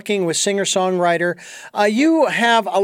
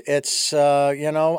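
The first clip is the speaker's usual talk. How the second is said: ordinary talk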